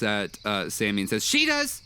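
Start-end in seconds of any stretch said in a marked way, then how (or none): 1.3-1.8 put-on much higher, eager kid voice; excited boy. Boy wizard points at Sammy, high eager voice. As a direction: blurt it out like a kid passing the buck.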